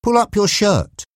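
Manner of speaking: high, firm repeated order; pressing command, no room for argument; insistent. A narrator's reading. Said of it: "Reggie insisted".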